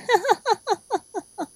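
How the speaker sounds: high giggling cackle. Acted. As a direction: giggle at his misery. Villain laughing.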